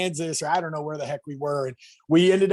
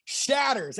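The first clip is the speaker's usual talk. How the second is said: usual speaking voice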